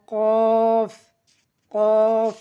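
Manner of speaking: loud, long "কফ" shoved out from the throat; pushed from throat. Shown as a demonstration. Teacher showing learners the letter, "কফ" long and pushed. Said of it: "গলা থেকে ধাক্কা দিয়ে".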